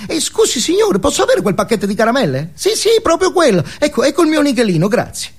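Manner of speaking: put-on high, fast child's voice; little boy. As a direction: play the eager little boy asking sweetly. (bambino piccolo, voce acuta e veloce)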